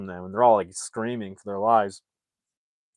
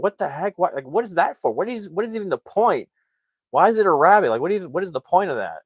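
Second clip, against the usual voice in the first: high-pitched baffled complaining; mock exasperation; 9 semitones higher, 4 dB louder than usual. Narrator as family after bumper falls off, mock exasperation. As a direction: question it in put-on disbelief.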